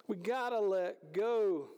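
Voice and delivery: usual speaking voice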